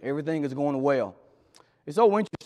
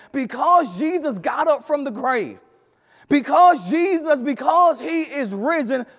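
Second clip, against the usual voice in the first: higher, louder climactic sermon voice; rising pulpit fervor; full-force preaching; 7 semitones higher, 6 dB louder than usual. Acted.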